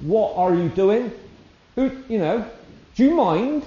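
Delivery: high, loud mock indignation; indignant rebuke. Imagining a man scolding the woman, high and indignant. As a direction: snap at her as if offended.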